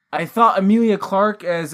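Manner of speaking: ordinary talk, nothing marked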